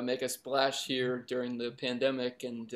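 ordinary talk

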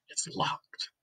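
whispered, breathy secret tone; hushed whisper. A narrator's reading. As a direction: whisper it like you're afraid to be heard. Dark door found, tense narration.

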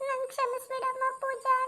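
ನನ್ ಕ್ಷಮಿಸ್ಬಿಡಮ್ಮ ಪೂಜಾ pleaded high through tears; crying plea. Acted. Mother-in-law weeps ನನ್ ಕ್ಷಮಿಸ್ಬಿಡಮ್ಮ ಪೂಜಾ at her bedside, high crying plea.

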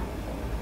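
quick intake of breath; short, sharp surprised gasp; sudden gasp. Real, not acted. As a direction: gasp on spotting a way out. Suddenly spots a ladder.

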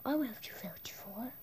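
soft unvoiced whisper. Real, not acted. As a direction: whisper the vote so nobody hears. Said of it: "they can't hear anything".

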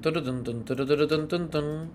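ordinary delivery

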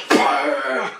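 high explosive "wah"; imitated punch noise. Storyteller imitating the spiked-knuckle punch, high explosive noise. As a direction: mimic a spiked fist smashing down. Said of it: "and he just goes".

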